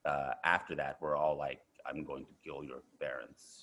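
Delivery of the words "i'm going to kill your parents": low, quiet threat; lightly voiced Russian villain, hushed; menacing Russian character. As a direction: deliver a soft, low menace as a stock Russian heavy. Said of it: "most Russian characters that I played".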